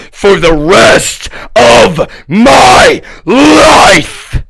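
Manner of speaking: slow shout climbing very high; furious vow. Real, not acted. Vowing never to be done, furious drawn-out shout. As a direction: drag out each word into a full shout.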